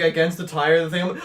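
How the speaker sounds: ordinary talk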